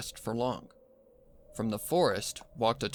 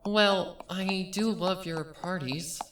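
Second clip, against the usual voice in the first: much higher, slow little-sister voice; fond younger sister; 8 semitones higher, slower than usual. A narrator's reading. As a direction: gently admit it with affection. Younger sister Bellamina speaks.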